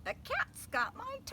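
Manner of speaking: high squeaky voice; squeaky butcher. Acted. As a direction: squeak it like a mouse.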